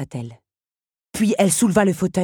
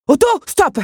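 high, loud roar of command; roared order; 13 semitones higher, 9 dB louder than usual. A narrator's reading. Henri roars at Otto to stop cutting strings, furiously loud. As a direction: roar at him to halt. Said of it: "rugit Henri".

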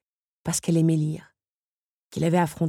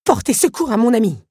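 higher, louder, determined reply; Otto retorts; 7 semitones higher, 6 dB louder than usual. Acted. Otto answers Henri while struggling up, determined. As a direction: stand firm for a friend. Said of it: "répliqua Otto".

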